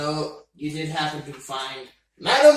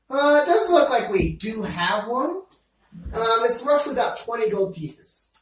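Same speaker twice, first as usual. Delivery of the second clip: higher Scottish shopkeeper voice; Scottish accent; 5 semitones higher, 3 dB louder than usual. Acted. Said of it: "I'm going into a Scottish accent again".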